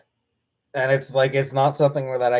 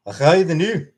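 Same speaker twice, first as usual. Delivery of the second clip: a Scottish accent put on for chat; throwing on a Scottish accent; 4 semitones higher, 6 dB louder than usual. Shown as a demonstration. Scottish accent shown off to chat. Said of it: "there's my Scottish accent".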